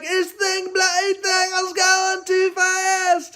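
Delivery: put-on high, loud panicked English accent shout; a British kid yelling out of control. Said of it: "English accent".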